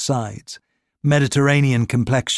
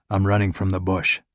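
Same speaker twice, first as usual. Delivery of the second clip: Ricard's voice; 5 semitones lower than usual. A narrator's reading. Injured Ricard to the saleswoman, low and hushed.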